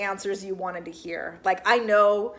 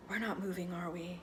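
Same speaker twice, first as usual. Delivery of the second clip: soft, much quieter, almost a breath; small broken question; 4 semitones lower, 16 dB quieter than usual. Retold or imitated. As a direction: ask it barely above a whisper, fearing the answer. Christine asks, about to cry.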